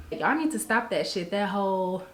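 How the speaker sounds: ordinary delivery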